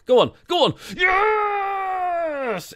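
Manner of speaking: huge high cheering shout of joy; goal celebration. Real, not acted. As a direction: yell it out as the ball goes in.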